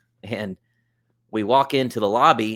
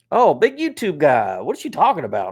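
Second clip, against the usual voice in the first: mocking tease; 6 semitones higher, 6 dB louder than usual. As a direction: rub it in playfully. Project manager teasing him.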